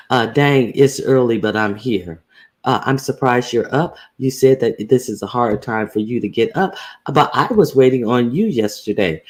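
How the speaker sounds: ordinary talk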